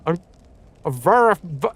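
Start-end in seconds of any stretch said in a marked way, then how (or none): none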